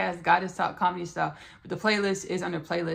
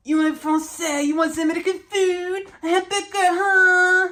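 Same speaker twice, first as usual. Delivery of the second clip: put-on very high, loud French-accented squawk; hammed-up French accent, stretching the final huh; mock French accent; 12 semitones higher, 8 dB louder than usual. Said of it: "that would act like, react like that".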